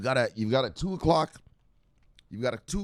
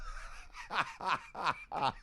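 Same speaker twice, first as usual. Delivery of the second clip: higher, wheezy chuckle; breathy laughing; 6 semitones higher, 9 dB quieter than usual. Real, not acted. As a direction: break up at your own joke. Laughs at own hat joke.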